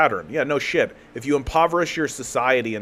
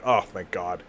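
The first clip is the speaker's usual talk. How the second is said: relieved sigh; 7 semitones lower, 6 dB quieter than usual. Retold or imitated. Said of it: "literally everybody went".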